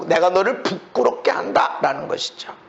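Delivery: ordinary talk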